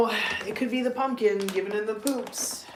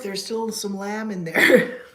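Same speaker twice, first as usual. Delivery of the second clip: ordinary talk, nothing marked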